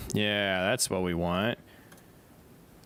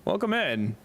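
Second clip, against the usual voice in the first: bright, raised thank-you; excited greeting; 10 semitones higher than usual. Real, not acted. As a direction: greet the new follower. Greeting follower over ping.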